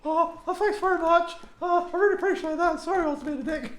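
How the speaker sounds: high, squeaky flustered thanks; flustered gushing. Retold or imitated. Caught-out author gushes thanks to a fan, high and flustered. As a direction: gush and squeak an embarrassed apology.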